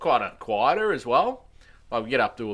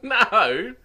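shocked no; 12 semitones higher, 9 dB louder than usual. Retold or imitated. Retold disbelief at mate's mistake.

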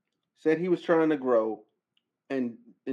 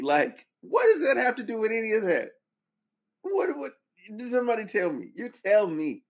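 exasperated outrage; 8 semitones higher than usual. Real, not acted. Ranting at [name] over threats to [name], high and incredulous. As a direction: demand an answer, incredulous.

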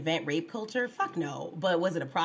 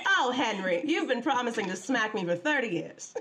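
higher-pitched mock wife, laughing at the end; old-timey wife; 3 semitones higher than usual. Host as wife answering husband's threat, old-timey wife. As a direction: play a long-suffering old-timey wife. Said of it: "we should play husband and wife".

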